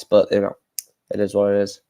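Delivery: talking through hiccups; hiccuping between words. Real, not acted. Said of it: "Can't stop hiccuping now".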